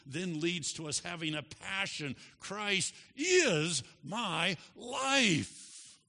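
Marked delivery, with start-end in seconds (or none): 2.4-6.0 very slow, high, loud declaration; ringing pulpit climax; emphatic proclamation. Acted. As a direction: stretch out "is" and "life" with force. Climactic declaration of the sermon's theme.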